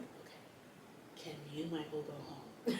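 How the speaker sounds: unvoiced whispered request. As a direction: whisper so the baby stays asleep. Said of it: "I'm like".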